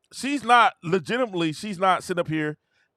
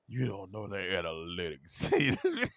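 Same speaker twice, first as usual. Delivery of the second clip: low, slow, soft know-it-all; smug fan voice; 10 semitones lower, 9 dB quieter, slower than usual. Retold or imitated. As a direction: mimic a condescending fan. Imitating fans' smug claim.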